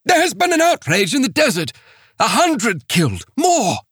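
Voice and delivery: high, fast horrified outburst; frantic missionary. Acted. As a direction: blurt out the massacre in panic. Missionary reports massacre.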